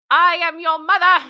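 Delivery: high, loud domineering mother; bossy mother. Acted. Mother scolding her adult daughter, domineering and loud. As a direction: snap it like an entitled mom.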